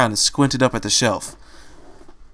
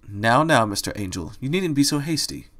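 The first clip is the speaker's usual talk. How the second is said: ordinary delivery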